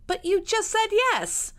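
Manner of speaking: high, slow, sulky complaint; pouting. A narrator's reading. Georgiana to her brother who refused the seaside, pouting. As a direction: draw it out like a pouting girl. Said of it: "Georgiana pouted".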